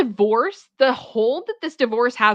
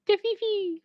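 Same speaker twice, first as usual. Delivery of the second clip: "The fifi" 用高而尖细的假声说出; 把 "fifi" 抬高成娇声的小声音; high squeaky fifi; 10 semitones higher, 4 dB quieter than usual. Shown as a demonstration.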